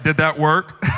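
put-on a cheeky toddler checking if it worked; sly side-eye. Toddler side-eyeing his mom, sly and testing. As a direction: slip it out sideways, testing. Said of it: "side-eyes you".

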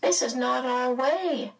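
high, regretful voice; gently regretful, pitched high; sad. A narrator's reading. Mrs Whatsit telling Meg no, sadly. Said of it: "Missus Whatsit said sadly".

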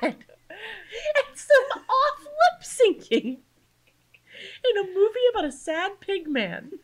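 high, very drawn-out disbelief; high-pitched comic outrage; incredulous rant. Real, not acted. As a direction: stretch it out in laughing disbelief. Climax of incredulous recap.

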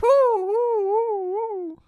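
high loud hooting; owl hoot. Pretend. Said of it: "You could be like".

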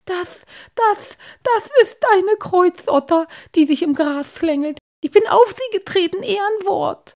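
high-pitched, loud panic; scared little character, shrilly insisting; frightened stammer. A narrator's reading. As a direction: blurt it out in a squeaky fright. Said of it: "brachte Halbschuh schließlich hervor".

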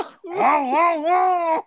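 high, loud theatrical wail; mock sobbing. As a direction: bawl like a bad comic actor. Mock wailing in French lament.

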